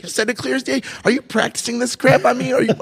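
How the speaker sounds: high-pitched, indignant disbelief; incredulous retort. Retold or imitated. Patient challenging his doctor's blunt words, incredulous and high-pitched. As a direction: shoot back questions in a high, incredulous voice.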